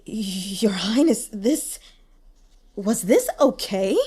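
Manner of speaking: slow, hesitant and shaky; trembling voice. Acted. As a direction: stammer it out nervously. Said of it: "said in a trembling voice".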